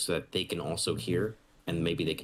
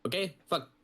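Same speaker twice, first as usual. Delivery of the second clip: put-on much higher, rushed Micronesian accent full of swearing; mock Micronesian accent; 11 semitones higher than usual. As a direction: imitate the sergeant's thick Micronesian accent, barely understandable. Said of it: "he has a strong accent".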